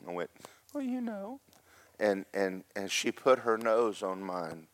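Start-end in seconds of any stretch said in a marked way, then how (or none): 0.7-1.4 soft, very high mumble; mock-meek falsetto; sheepish self-imitation. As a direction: squirm it out in a small high voice. Retelling his sheepish reply.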